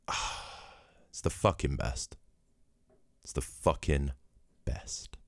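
breathy near-whisper of blissful memory; hushed rapture. Retold or imitated. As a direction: drop to a low, hushed, almost whispered confession. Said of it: "And he just went".

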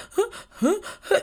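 put-on high, breathy sob-gasps like a crying kid; hiccuping sobs. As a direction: mimic the gulping breaths of a hysterical child. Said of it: "like that".

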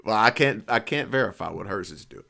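normal talking voice

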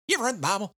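put-on high-pitched hammy Texas accent; Hickman's accent. Hickman asking David about the Bible, Texas accent. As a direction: put on a Texan twang for the question. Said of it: "Hickman lays it out and asks David".

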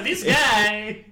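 put-on high, drawn-out vaguely Slavic accent; stock foreign accent. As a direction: stretch it out in a generic foreign accent. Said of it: "non-offensive foreign accent".

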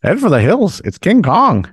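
high squawky alarm call; cartoon bird. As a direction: imitate a clock bird sounding the alarm. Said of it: "The bird pops out of the clock".

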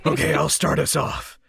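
lightly put-on vigilante voice; playful Batman impression. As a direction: start off in a mock superhero voice. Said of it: "we should all do Batman voices".

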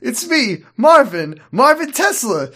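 high, laughing goofy character voice; cheerful, laughing high cartoon huckster; Marvin Tesla voice.

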